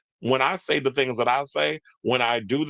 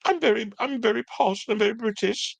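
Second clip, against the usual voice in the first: much higher, hammed-up posh British accent; mock posh British; 11 semitones higher than usual. Host mocking [name]'s fake British phase in a high posh British accent. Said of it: "she tried to be all".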